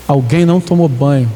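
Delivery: normal talking voice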